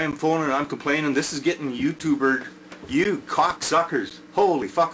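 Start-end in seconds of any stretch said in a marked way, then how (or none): 2.9-4.2 real anger. Real, not acted. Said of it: "I'm mad". 4.3-4.9 Holy fuck with a rising, exasperated Holy; real anger. Real, not acted. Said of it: "I'm mad".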